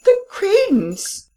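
very high-pitched cry of shock; choked, high-pitched outcry; stammered, choked cry. A narrator's reading. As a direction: cry out high, choking on the words. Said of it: "the Baron cried out".

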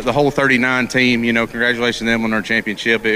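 usual speaking voice

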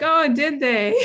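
loud, high, amused fake surprise; mock surprise. Scientist answering someone explaining her own study to her, sarcastically surprised. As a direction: act amazed through a laugh.

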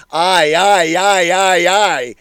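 loud, odd-voiced babble of repeated aye; babbling. A narrator's reading. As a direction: babble it like a madman at his antics.

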